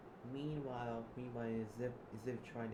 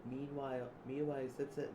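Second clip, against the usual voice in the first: ordinary talk, nothing marked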